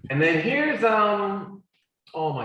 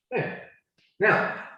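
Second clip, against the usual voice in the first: ordinary talk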